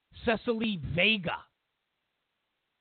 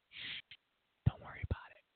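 hushed whisper; shushing; 11 dB quieter, faster than usual. Retold or imitated. American brushing off the foreigner's question, hushed whisper. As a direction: whisper it like hushing up a secret.

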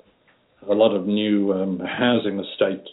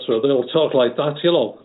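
lightly imitated nasal Liverpool Scouse, higher pitch; mock Scouse accent; 5 semitones higher than usual. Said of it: "another telling thing in Liverpool was the accent".

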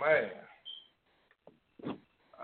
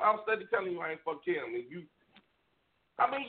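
ordinary talk, nothing marked

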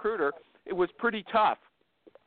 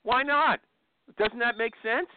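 challenging; 7 semitones higher than usual. Real, not acted. Host challenges guest's refusal.

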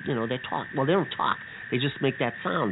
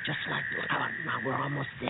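hushed whisper; 4 semitones lower, 6 dB quieter than usual. Acted. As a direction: whisper so the dogs stay asleep. Sneaking near guard dogs.

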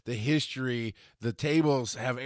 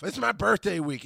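higher, mock-excited brag; mocking imitation; 8 semitones higher than usual. Host imitates a birthday-week braggart, mockingly. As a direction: imitate someone announcing it to everyone.

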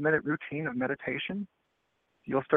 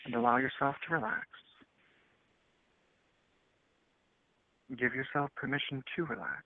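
guided meditation; 11 dB quieter than usual. Acted.

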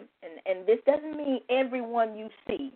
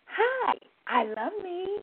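high, slow, sing-song hello; very cheerful greeting; 9 semitones higher, 4 dB quieter, slower than usual. Shown as a demonstration. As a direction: greet a stranger brightly and warmly. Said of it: "say very cheerfully".

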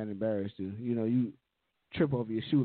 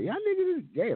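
high exclaiming. Retold or imitated. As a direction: voice shoots up high. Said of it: "I was like".

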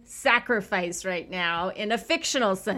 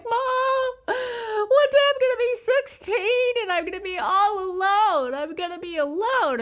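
put-on high, wailing child voice, much higher and louder; crying little boy; 13 semitones higher, 4 dB louder than usual. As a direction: imitate a sobbing kid running to his mom. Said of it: "he ran crying to his mom".

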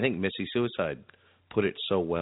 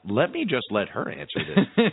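laughing; 9 semitones higher, 4 dB louder than usual. Real, not acted. Laughing while cueing a clip.

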